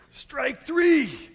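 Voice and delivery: very high, loud umpire shout; umpire's call. Retold or imitated. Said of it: "And then I hear".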